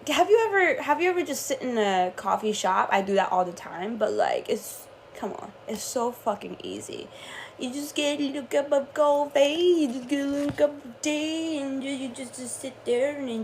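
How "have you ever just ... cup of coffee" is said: ordinary talk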